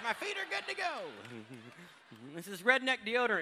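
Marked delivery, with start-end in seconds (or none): 0.0-0.8 high mock woman.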